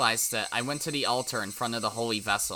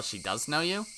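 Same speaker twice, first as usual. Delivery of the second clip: ordinary delivery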